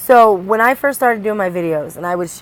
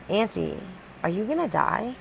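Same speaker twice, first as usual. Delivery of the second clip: softer, small innocent question; soft child question; 10 dB quieter than usual. Retold or imitated. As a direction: ask gently like a little girl. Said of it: "she looks up and says".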